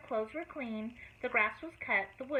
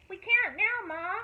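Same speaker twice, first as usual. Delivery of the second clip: high yell back to Ma; kids yelling back; 8 semitones higher, 5 dB louder, slower than usual. A narrator's reading. Toby and Jane yelling from the yard, high and loud. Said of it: "yelled Toby and Jane".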